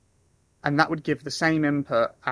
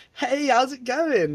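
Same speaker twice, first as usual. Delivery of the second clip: imitated cheery caller; 10 semitones higher, 4 dB louder, much slower than usual. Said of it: "people are like".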